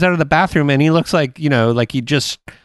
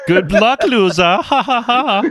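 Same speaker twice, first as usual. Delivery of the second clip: mock taunt; 7 semitones higher, slower than usual. Mock customs officer taunt.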